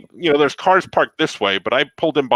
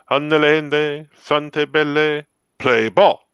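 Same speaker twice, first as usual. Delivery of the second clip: mock Italian pope; much slower than usual. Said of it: "He goes".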